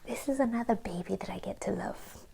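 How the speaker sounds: very soft, breath-only whisper of wonder; awed whisper. Retold or imitated. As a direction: whisper it tenderly, as if marvelling. Retells awed thought about new baby.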